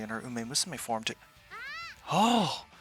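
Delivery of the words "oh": loud high gasp of realisation; sudden realisation. Acted. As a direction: realise it all at once.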